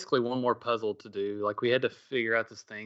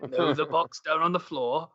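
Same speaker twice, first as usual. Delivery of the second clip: lightly imitated pirate accent, pitched up; 5 semitones higher than usual. Host hinting to stuck players, light pirate accent. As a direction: point out a hint in a mock pirate accent. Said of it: "still in his pirate accent".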